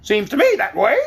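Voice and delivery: mild scorn in a hen voice; scornful. Acted. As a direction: sneer at the cat. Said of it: "said Billina scornfully".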